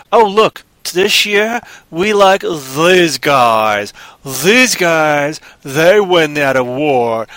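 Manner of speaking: loud, drawn-out mock announcement; exaggerated, slow mock favoritism; mocking Hollywood voters. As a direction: pompously play Hollywood picking its favorites, loudly. Mocking Hollywood picking award winners.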